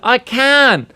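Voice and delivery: high mock holler; high-pitched retort, only pretending to yell; imitated yelling back. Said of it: "kept yelling".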